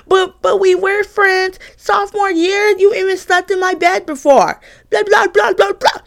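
high, whiny mock of the roommate. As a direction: pitch way up to mimic her pleading. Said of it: "She was like".

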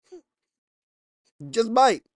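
preacher drags the warning out for the crowd; drawn-out warning. Shown as a demonstration. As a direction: stretch every word out ominously. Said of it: "repeated take drawn out".

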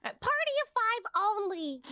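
high, slow, snooty repeat; mocking rude worker. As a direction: drag it out like a bored clerk. Mocking rude line worker again.

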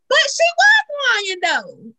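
retold protest, very high, dropping on the last word; high-pitched protest. Friend objecting to a scolding father, high protest. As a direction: squeal the objection high, then drop.

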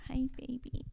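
put-on breathy whispered baby talk. Shown as a demonstration. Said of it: "What if it was like".

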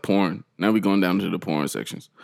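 usual speaking voice

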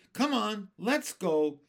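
loud, eager urging; chorused. A narrator's reading.

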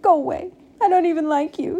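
high-pitched, upset whine; hammed-up weepy little pig; tearful rejection. A narrator's reading. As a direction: push them away while crying. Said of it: "began to cry".

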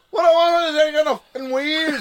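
put-on high, loud, fast pikey accent; Irish traveller accent. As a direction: blurt out the Snatch line in a thick traveller accent. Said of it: "I was just like".

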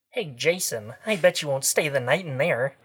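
put-on teasing teen girl dare. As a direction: taunt him like a bratty teen. Voicing teen girl Abigail.

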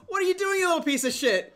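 high, loud furious yell; a father catching his son in the act; angry dad. Retold or imitated. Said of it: "And he says".

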